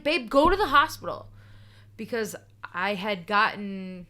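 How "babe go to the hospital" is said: urging. Retold or imitated. Urging girlfriend on the phone.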